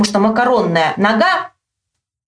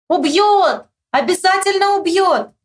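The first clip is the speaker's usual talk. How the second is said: high, stretched holler; high narrated yell; shouted agreement; 9 semitones higher than usual. A narrator's reading. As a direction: call back with full conviction. Friend mishears, shouts agreement.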